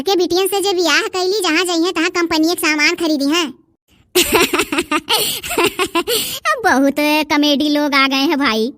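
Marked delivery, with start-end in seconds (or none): 4.1-6.6 amused laugh. Real, not acted. Laughs at the quarrelling women.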